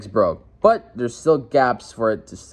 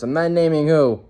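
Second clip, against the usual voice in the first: ordinary talk, nothing marked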